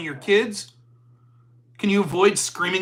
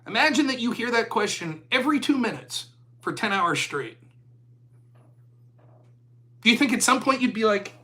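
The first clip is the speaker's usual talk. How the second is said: usual speaking voice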